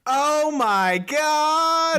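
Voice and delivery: drawn-out high oh my God; real slow shocked wail; stunned gasp. Real, not acted. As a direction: gasp it out slowly, floored. Shocked at jelly babies news.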